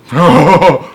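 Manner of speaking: loud burst of laughter; real laugh. Real, not acted. Reader laughing at the aunt's insult. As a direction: laugh out at the joke.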